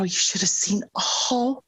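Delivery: usual speaking voice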